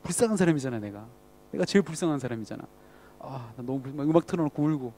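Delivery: low, subdued poor-me voice; quietly feeling sorry for oneself, lightly acted; mock self-pity. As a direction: act out wallowing in self-pity, softly. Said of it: "자기 연민에 빠지기도 하고요".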